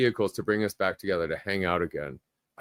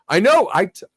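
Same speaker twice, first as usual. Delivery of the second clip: excited; 6 semitones higher, 6 dB louder than usual. Real, not acted. Excited about band returning.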